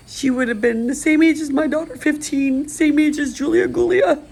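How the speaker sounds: fighting back tears as she speaks; choked-up, high, tearful voice; getting emotional. Real, not acted. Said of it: "I'm getting emotional".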